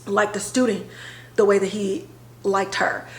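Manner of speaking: ordinary talk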